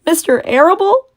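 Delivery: high, loud sobbing piglet. Acted. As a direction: sob out the name in disbelief. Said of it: "sobbed Wilbur".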